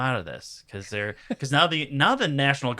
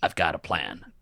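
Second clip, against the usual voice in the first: much lower, offhand retold line; understated mock-casual delivery; nonchalant; 9 semitones lower, 4 dB quieter than usual. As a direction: imitate someone coolly moving on. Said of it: "he just nonchalantly goes".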